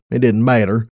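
usual speaking voice

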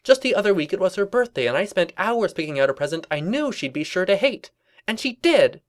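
high, emphatic cartoon griping; indignant Twilight. Twilight recounting the hated present, high indignant voice. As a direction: argue it proudly at high pitch.

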